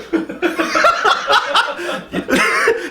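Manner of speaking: high burst of laughter; real, amused laughing fit. Real, not acted. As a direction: crack up laughing. Laughs at the quoted line.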